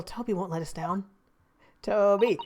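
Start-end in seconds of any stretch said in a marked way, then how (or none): 1.8-2.4 calling a dog, falling tune. Acted. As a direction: call out to the dog.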